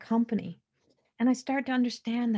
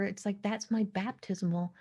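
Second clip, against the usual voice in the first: usual speaking voice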